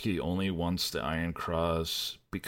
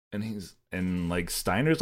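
usual speaking voice

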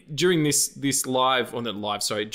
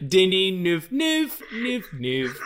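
silly repeated nickname, jumping high; goofy singsong name chant; funny name noise; 5 semitones higher, much slower than usual. Pretend. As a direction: play with the sound of the name. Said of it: "I like noises and funny words".

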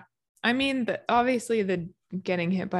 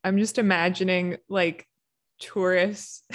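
ordinary talk

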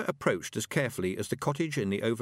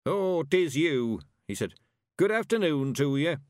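put-on a just-woken old man, a little louder; old countryman; 5 semitones higher, 4 dB louder than usual. A narrator's reading. Old Mister Weevil waking to greet Tiffany, drowsy.

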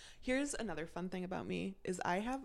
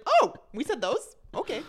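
loud, high, drawn-out mock astonishment; hammed-up surprised reaction; mock surprise; 10 semitones higher, 12 dB louder than usual. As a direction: act out startled surprise, loud and slow. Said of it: "listen back and be like".

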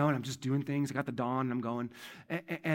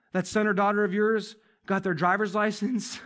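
ordinary talk, nothing marked

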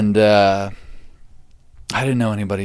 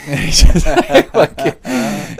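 laughing while speaking; 6 semitones higher, 8 dB louder, much slower than usual. Real, not acted.

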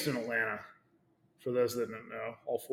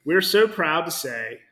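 corporate announcement voice; 4 semitones higher, 10 dB louder than usual. Acted. Reading the corporate post aloud.

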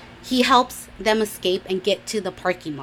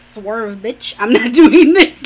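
sassy brush-off, building louder and higher; sassy rejection; 3 semitones higher, 12 dB louder, slower than usual. Retold or imitated. Girl refusing a guy's kiss, sassy and rising. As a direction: shove them away with a loud, laughing refusal.

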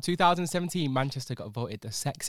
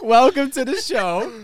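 high, loud welcome; hammed-up host welcome; show intro; 11 semitones higher, 8 dB louder than usual. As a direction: announce it like a show opener. Repeating podcast intro.

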